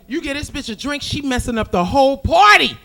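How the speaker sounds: ordinary delivery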